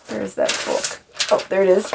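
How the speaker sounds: usual speaking voice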